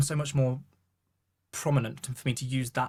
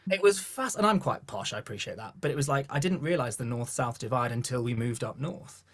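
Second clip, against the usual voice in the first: usual speaking voice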